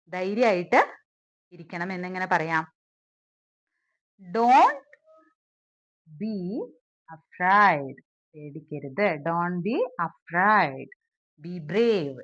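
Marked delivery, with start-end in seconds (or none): 4.2-8.0 slow dictation, long gaps between words. Shown as a demonstration. Teacher building the translation for learners, word by word. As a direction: give each word on its own, then wait.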